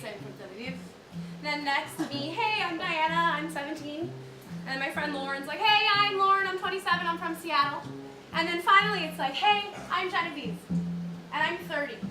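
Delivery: normal talking voice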